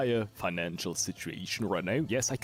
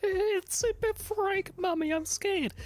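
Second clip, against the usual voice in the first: put-on high whimpering little kid; squeaky frightened child calling mommy; scared child voice; 4 dB louder than usual.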